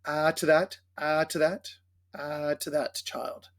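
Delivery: "at that" with both Ts separately released; double T. Shown as a demonstration. Choir director shows the double T in "at that". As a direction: sound the T ending "at" before starting "that". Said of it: "notice also the double T".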